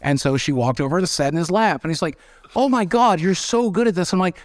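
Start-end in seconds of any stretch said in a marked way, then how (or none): 2.6-4.1 high, amazed gushing; excited. Retold or imitated. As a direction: gush like a wowed friend. Friend amazed at his skill.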